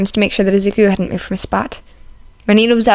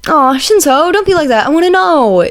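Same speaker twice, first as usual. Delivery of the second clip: high, loud pleading whine; whining; 7 semitones higher, 3 dB louder than usual. A narrator's reading. Izuku begging Shinzo for the story, high whine. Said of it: "Izuku whined".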